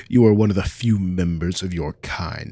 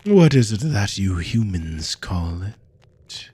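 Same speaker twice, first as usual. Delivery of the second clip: ordinary talk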